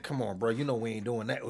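low, soft, casual brush-off; understated, quiet remark; calm objection. Retold or imitated. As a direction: gently wave it off, low and easy. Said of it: "I said it calm".

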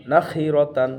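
ordinary delivery

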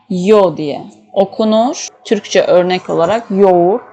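ordinary talk, nothing marked

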